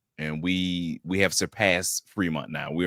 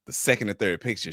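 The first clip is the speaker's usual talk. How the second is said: normal talking voice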